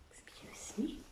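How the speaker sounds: a soft unvoiced excuse me; whispered aside. Real, not acted. Reader whispering an apology to listeners, breaking off. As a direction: whisper it off to the side, breaking from the reading.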